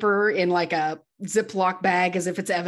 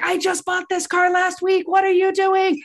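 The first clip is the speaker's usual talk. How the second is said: high-pitched imitation of a yelling car owner; outraged shout; 10 semitones higher than usual. Another man runs out to his smashed car, outraged mock shout. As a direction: holler in mock disbelief and outrage.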